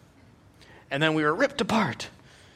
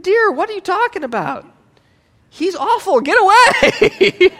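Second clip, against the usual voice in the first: exasperated advice; 11 semitones higher, 11 dB louder than usual. Retold or imitated. Pastor quoting own advice.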